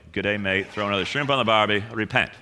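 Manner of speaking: put-on jokey, low-pitched Australian accent. Pastor joking with the congregation, mock Australian accent. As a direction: lightly put on an Aussie voice. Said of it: "I said it in Australian".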